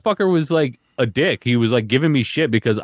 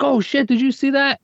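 excited brag; 11 semitones higher than usual. Retold or imitated. Bragging after lucky strike.

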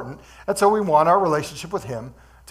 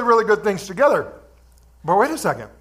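usual speaking voice

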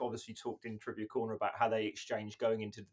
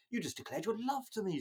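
mock Annie; 8 semitones higher than usual. Voicing Annie in retelling.

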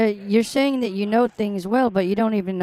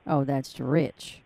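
sarcastic scoff; 5 semitones lower, 4 dB quieter, much slower than usual. Real, not acted. Mocking the hacker accusation.

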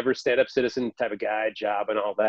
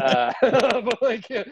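real laugh breaking into the words; high giggly laugh, real; 11 semitones higher than usual. Real, not acted. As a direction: crack up mid-sentence. Laughing at own story.

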